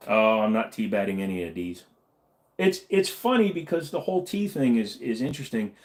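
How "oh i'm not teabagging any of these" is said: ordinary talk, nothing marked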